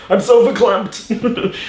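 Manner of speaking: high, slow, hammed-up choked-up voice; mock verklempt. Director mocking himself being teary at the premiere, hammed-up. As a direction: overdo being teary on stage. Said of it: "if you're just like".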